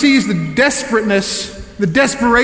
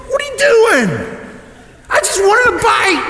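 shocked outraged yell; 13 semitones higher than usual. Retold or imitated. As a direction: yell it like your cake just flew away. Outraged at wife tossing cake.

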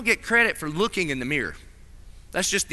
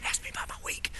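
imitated hushed, unvoiced aside; eager undertone; 14 dB quieter, faster than usual. Worker angling to report to the boss, playful whisper. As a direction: slip it in very softly, as if hinting.

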